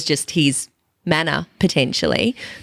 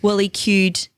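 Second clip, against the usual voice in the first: ordinary talk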